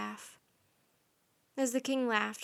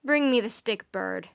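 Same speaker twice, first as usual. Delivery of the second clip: commanding; 7 dB louder than usual. A narrator's reading. King orders the raven.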